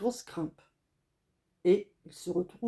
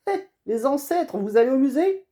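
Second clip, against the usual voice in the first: higher, louder jeering; mocking teens; 7 semitones higher, 8 dB louder than usual. Teenagers on snowmobiles jeer at the family's sled, loud mockery. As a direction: taunt the old-timers.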